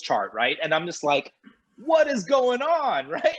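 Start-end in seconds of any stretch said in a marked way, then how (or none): 1.8-3.4 slow, high, laughing "What is going on?"; laughing disbelief. Retold or imitated. Song hits the charts, he laughs in disbelief. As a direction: laugh through the words in disbelief.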